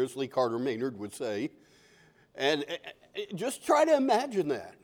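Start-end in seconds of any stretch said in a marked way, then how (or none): none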